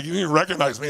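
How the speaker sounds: ordinary delivery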